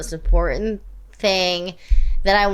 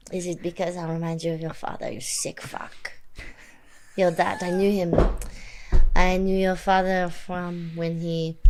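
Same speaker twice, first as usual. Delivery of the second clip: quietly threatening vendetta speech; hushed villain confession, unhurried; menacing spy villain; 3 semitones lower, 4 dB quieter than usual. Pretend. Spy villain revenge monologue.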